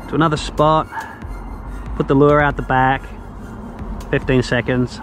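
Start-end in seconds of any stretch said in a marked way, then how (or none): none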